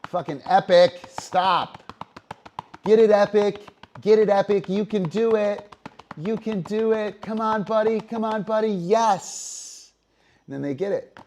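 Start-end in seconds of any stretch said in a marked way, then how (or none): 0.0-9.9 high, loud, over-eager yelling at the dog; frantic cheering. Shown as a demonstration. Owner yelling nonstop at the dog Epic, frantic and high. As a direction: pile on noisy encouragement like a fan. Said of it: "If the dog is doing this and you say".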